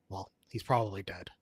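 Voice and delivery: low, understated deadpan quote. Retold or imitated. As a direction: deliver it deadpan, dropping pitch and volume.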